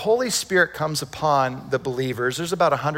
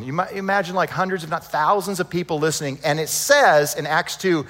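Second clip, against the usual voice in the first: ordinary talk, nothing marked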